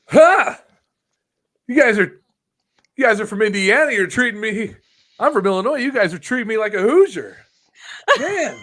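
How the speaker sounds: loud, high promo rant, badly imitated; hammed-up high, loud wrestler on the mic; terrible C M Punk. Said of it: "This is my C M Punk impression".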